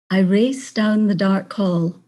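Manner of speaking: ordinary delivery, nothing marked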